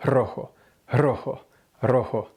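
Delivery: Rojo with a breathy trilled r; rolled r. Shown as a demonstration. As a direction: push air through the r of Rojo. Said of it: "push air out from your lungs".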